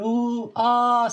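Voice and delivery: high, drawn-out "ooh, aah"; imitated soundtrack wails. Mocking a TV series' drawn-out scene, imitated soundtrack wails. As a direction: mimic long, high background wailing. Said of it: "sesleri geliyor".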